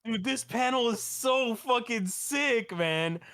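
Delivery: high, drawn-out awe at the panel; excited raving. Real, not acted. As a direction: gush like a thrilled fan. Raves about a manga panel.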